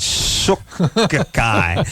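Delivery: "Suck-eye" stretched out slowly in pieces; drawn-out coinage. Pretend. Host savouring his joke word, "Suck-eye" drawn out. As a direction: break "Suck-eye" apart and linger on it.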